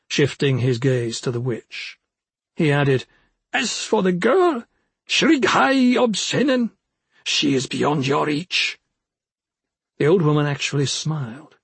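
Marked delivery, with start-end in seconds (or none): 3.5-8.8 high, proud little forest imp. Acted. Imp speaks.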